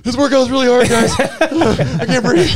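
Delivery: high, laughing, slow gasping mock complaint; mock breathless athlete. Athlete joking about wearing a live mic, gasping. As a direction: pretend to be wiped out mid-workout.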